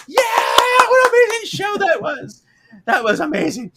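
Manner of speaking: very high, loud gushing cheer; excited crowd. Retold or imitated. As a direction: rave like a thrilled audience. Crowd cheering after show.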